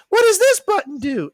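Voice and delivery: very high-pitched cartoon sister voice; Dee Dee. Imitating Dexter's sister before she touches something, high mock cartoon voice. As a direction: squeak the question like a nosy cartoon girl. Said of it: "Dee Dee used to always say".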